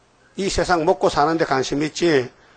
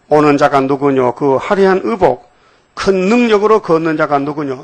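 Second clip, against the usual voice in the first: scripture reading; 5 dB louder than usual. Acted. 성경 구절을 낭독. (장엄하게 크게 낭독)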